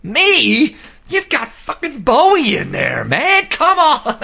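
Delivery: very high, incredulous outburst; puzzled disbelief. Retold or imitated. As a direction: squeal it in hammed-up disbelief. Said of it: "puzzled, and goes".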